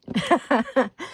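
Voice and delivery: light rippling laugh. Real, not acted. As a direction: giggle at your own remark. Laughs at own remark on supplies.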